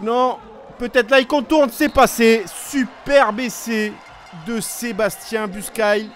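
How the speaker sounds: loud, high, stretched try call; excited sports commentary. Real, not acted. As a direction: belt out the equalising try. French try scored, live call.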